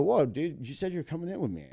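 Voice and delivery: high, quick objection with a loud start; alarmed protest. Retold or imitated. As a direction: protest as someone walks off on you. Protesting as the star walks away.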